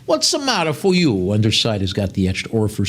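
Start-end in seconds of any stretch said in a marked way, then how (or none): 0.1-1.3 put-on high playful Italian-accented outburst; mock Italian. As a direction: throw it out like a hammed-up Italian. Put-on Italian-style exclamation.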